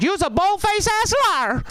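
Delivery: very high, sassy mock woman's voice; hammed-up lady calling him out. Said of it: "she goes".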